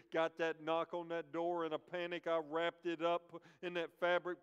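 loud, driving preacher's build-up; preaching at full force. Acted. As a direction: drive the story home from the pulpit, loud.

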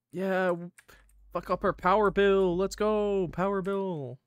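high, drawn chant of triumph; mock cheer. Mock cheering over power bill.

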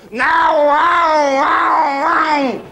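high shouted wow wow wow; Nigel Mansell impression. Warm-up man mimics Nigel Mansell for the crowd, shouting high. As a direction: yell it up high like a racing commentator. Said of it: "Oh, Nigel Mansell".

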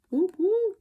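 high, loud celebratory whoop; excited high whoops; whoop whoop cheer. Real, not acted. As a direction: cheer like you won. Celebrating paid-off amount.

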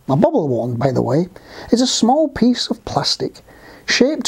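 put-on lively, projected children's storytelling voice; story narration. A narrator's reading. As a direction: read it out clearly to young listeners, with swooping pitch.

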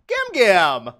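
loud hammed-up cry of protest; mock-scandalized shout. Grandchild calling out grandma in Congress, mock-scandalized shout. Acting shocked at grandma's proposal.

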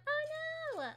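high, sudden oh no; dismayed cry. Real, not acted. Gamer crying out at a mishap, dismayed. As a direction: yelp in dismay.

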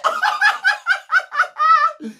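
a squealing burst of laughter; high shrieking laugh. Real, not acted. Laughing at the clip.

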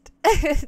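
loud, high laugh burst. Real, not acted. Streamer cracking up over the timeout mix-up, loud. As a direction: let a real laugh break out. Laughing at own failed attempt.

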